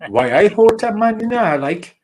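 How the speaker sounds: a hammed-up Geordie "man, you know"; playful attempt at a Geordie accent. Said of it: "try to do a Geordie accent".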